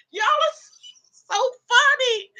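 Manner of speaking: said high through laughter; laughing. Real, not acted. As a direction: squeeze it out while still laughing. Still laughing at the chat.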